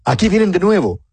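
mock lookout calling out again; a lightly acted sentry shouting from the wall; watchman's cry. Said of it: "El atalaya en el muro gritó".

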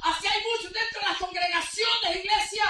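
loud, high, fervent pulpit preaching; preaching at full force. Acted. Pastor rousing the congregation, loud fervent preaching. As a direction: drive the message home to the pews.

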